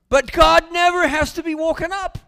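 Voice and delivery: a big preacher's shout; booming proclamation. Real, not acted. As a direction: ring it out to the whole church. Preacher's emphatic climax.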